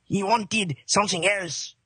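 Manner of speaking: slow, hammed-up French accent; mock French accent, unhurried. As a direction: draw it out in a comic French accent. Plays French tiger's keeper.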